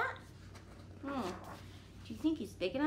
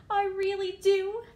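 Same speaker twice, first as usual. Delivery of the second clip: eager, louder pigeon plea; 7 semitones higher, 6 dB louder than usual. Acted. Teacher voicing the pigeon, eagerly pleading.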